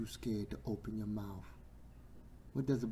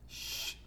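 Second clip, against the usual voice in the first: soft "shh"; hushing shush; 5 dB quieter than usual. Acted. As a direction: hush someone down. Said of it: "didn't want him to say nothing".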